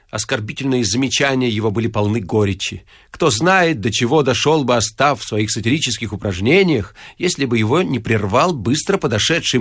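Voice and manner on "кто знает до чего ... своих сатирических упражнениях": ordinary delivery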